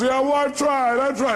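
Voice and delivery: high-pitched imitation of a complaining caller; mock whining. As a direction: whine the excuse in a put-on voice. Voicing the caller's excuses.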